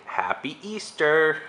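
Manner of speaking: slow, clear "Happy Easter"; slow demo. Shown as a demonstration. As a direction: spell it out slowly for a toddler.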